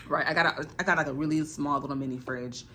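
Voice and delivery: normal talking voice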